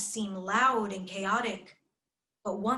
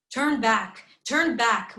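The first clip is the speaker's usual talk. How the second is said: louder urgent call, stressing turn; Mama's warning; 2 semitones higher, 7 dB louder, slower than usual. Retold or imitated. Mama warning her daughter away from the march, loud. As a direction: call them back loudly and firmly. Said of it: "Mama would say".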